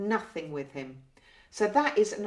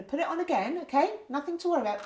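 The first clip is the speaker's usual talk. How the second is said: reassuring; 7 semitones higher, faster than usual. Shown as a demonstration. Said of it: "what can I do to reassure".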